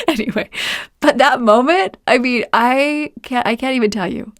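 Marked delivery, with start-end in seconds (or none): none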